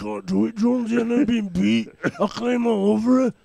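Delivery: put-on high, loud, drawn-out take on Austin's accent; exaggerated Austin accent, loud and high; Austin accent impression. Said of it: "in an Austin accent".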